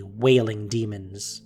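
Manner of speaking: ordinary talk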